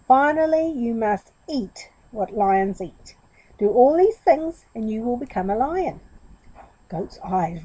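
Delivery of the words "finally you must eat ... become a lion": a grand final verdict; dramatic. Acted. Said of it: "a dramatic pose".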